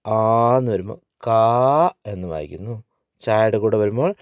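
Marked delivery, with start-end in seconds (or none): 1.3-1.9 കാ with a long, held aa; long vowel. Shown as a demonstration. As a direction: stretch the vowel in കാ. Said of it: "ദീർഘം കണ്ടു കഴിഞ്ഞാൽ ആ". (കാ എന്ന് ദീർഘമായി നീട്ടി)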